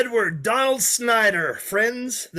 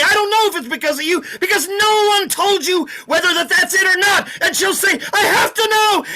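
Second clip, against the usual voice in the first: much higher, louder mock melodrama; hammed-up lovers' scene; 12 semitones higher, 6 dB louder than usual. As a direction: act out both lovers in a squeaky falsetto. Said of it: "And you'll say".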